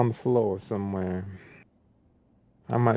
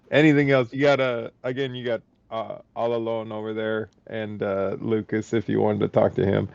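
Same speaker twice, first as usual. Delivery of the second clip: normal talking voice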